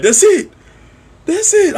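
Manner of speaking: high, urgent exclamation; retold excitement. Fan seeing both finishers land, retold excitement. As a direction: pitch it way up, as if the win is here.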